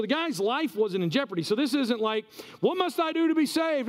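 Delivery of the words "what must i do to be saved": high-pitched cry for help; acted urgent begging; mock desperate plea. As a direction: plead as if your life is at risk, voice raised high. Acting the misread panicked plea.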